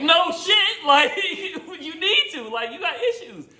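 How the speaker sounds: high, laughing "no shit"; laughing scoff. Retold or imitated. Narrator hearing friend is going to rehab, laughing scoff. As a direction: crack up at the obvious news.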